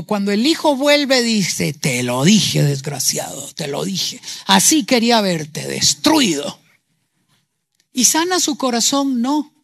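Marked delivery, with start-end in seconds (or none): 1.7-6.6 low, cold and spiteful gloating; bitter wounded father. Acted. Wounded father gloating over his returning son, low and spiteful. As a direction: sneer it at the son who failed. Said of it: "Si el padre hubiese estado herido".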